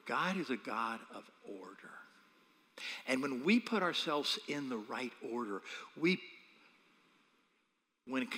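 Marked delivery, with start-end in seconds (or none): 0.1-1.9 whispered emphasis. Acted. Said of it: "preaching softly".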